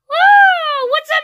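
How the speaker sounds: high-pitched, loud squealed greeting; piercing, high cartoon cheer; excitable Toad voice. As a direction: squeal hello in a high puppet voice.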